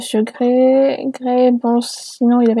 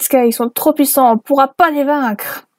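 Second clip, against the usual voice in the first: usual speaking voice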